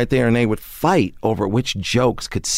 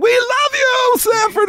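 put-on little old ladies; slower than usual. Old ladies at the taping swooning, high and adoring. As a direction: coo it like an adoring fan. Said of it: "And they were like".

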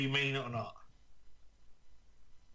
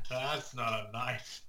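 mock Australian; 2 semitones lower, slower than usual. Crocodile Dundee knife quote.

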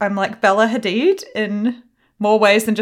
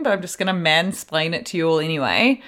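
ordinary delivery

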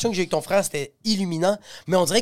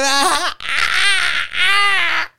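sanglots imités; 12 semitones higher, 5 dB louder, much slower than usual. Retold or imitated. Pleurs imités dans l'histoire.

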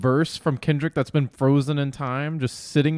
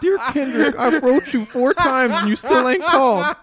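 high laughing fan-letter voice; squeaky superfan, laughing through it; obsessed fan; 12 semitones higher, 7 dB louder than usual. Acted. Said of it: "fan letter voice".

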